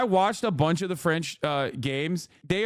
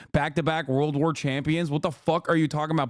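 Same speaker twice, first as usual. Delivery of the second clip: usual speaking voice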